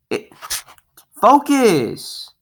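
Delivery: high, drawn-out command; exasperated yell. Real, not acted. Ordering the camera to focus, high exasperated cry. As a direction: let the frustration squeal out.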